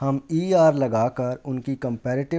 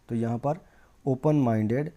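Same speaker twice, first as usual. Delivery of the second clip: normal talking voice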